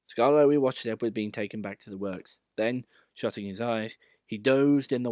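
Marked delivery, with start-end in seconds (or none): none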